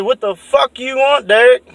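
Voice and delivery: high, loud demand; angry shout. Retold or imitated. Yelling at Derek in the dark, loud demand. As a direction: bark it down the driveway.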